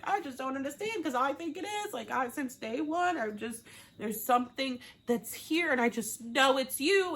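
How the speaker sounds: high, pleading mock lovesickness; laying it on thick. Imitating Natasha insisting to Tom, hammed-up pleading. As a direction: plead up high, overdoing the devotion. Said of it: "keeps laying it on thick".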